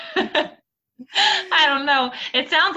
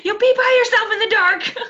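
mock kid threat; 10 semitones higher, 5 dB louder than usual. Mocking the kid's threat.

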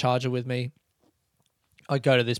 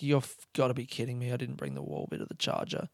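exasperated mutter; 3 semitones lower, 12 dB quieter than usual. Retold or imitated. Frustrated muttering to self.

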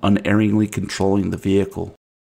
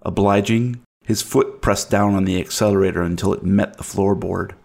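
usual speaking voice

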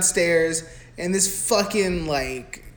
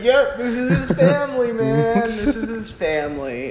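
laughing; 6 semitones higher than usual. Real, not acted. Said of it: "laughing comment".

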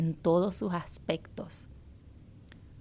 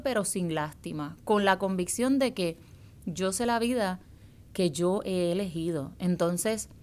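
ordinary talk

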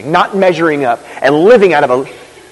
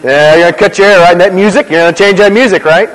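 louder, prodding nag; lightly voiced nagging church member; mock pushy churchgoer; 3 semitones higher, 5 dB louder than usual. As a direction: imitate a busybody correcting him. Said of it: "Someone walks up to him and says".

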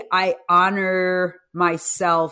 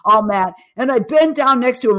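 usual speaking voice

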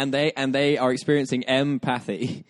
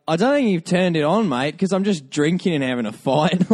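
higher-pitched mock Australian bloke accent; Australian man; 5 semitones higher, 3 dB louder than usual. Australian man puzzled by a VR headset, mock Australian accent. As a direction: play a baffled Australian mate. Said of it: "an Australian man experiencing homelessness".

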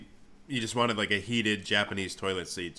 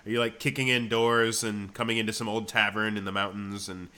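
ordinary talk, nothing marked

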